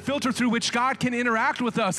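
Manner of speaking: high, loud preaching at full force. Acted. Preacher urging the congregation, full force. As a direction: drive it home from the pulpit.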